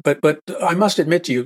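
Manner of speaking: usual speaking voice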